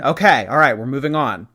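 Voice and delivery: usual speaking voice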